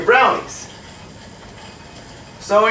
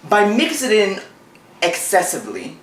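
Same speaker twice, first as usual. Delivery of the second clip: ordinary talk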